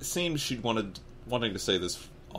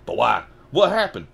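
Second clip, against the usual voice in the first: loud urgent questions, jumping higher; 4 semitones higher, 9 dB louder than usual. Acted. Harry asking about his parents, loud and urgent. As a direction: demand an answer at once. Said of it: "asked Harry urgently".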